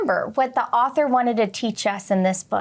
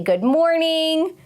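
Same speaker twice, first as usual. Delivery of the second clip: a slow, drawn-out sleepy good morning, pitched up; 6 semitones higher, slower than usual. Retold or imitated. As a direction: yawn it out like just woken. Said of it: "You might say".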